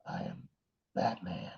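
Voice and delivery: very low, hushed gravel voice; gravelly superhero rasp, barely voiced; Batman. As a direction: growl it softly, way down deep. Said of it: "You gotta have the gravel voice".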